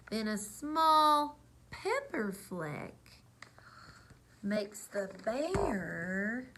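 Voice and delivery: lively storybook read-aloud; reading to children. A narrator's reading. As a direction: read it like bedtime story time. Reads picture book aloud.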